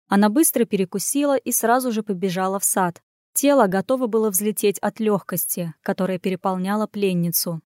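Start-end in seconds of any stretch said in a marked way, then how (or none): none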